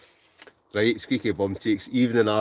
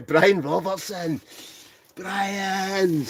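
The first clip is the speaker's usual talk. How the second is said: high, drawn-out call of his name; excited greeting; 11 semitones higher, much slower than usual. Real, not acted. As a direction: hail a mate, stretching the name out high. Greets a friend joining the livestream.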